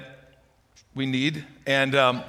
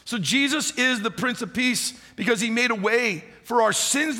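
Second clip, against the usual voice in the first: higher, emphatic sermon delivery; preaching; 6 semitones higher than usual. Real, not acted. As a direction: drive the message home to the church. Preaching to the congregation.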